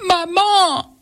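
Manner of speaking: high cry for mother; calling out. A narrator's reading. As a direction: call out for mom, alarmed. Wife wakes calling mother. (appel aigu et alarmé)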